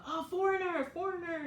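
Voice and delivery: high shouted call of foreigner; kids calling out. Retold or imitated. Kids pointing and shouting at a foreigner, high call. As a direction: yell it across the street like a kid. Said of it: "point at me from far away and scream out".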